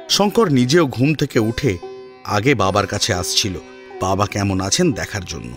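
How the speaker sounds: ordinary delivery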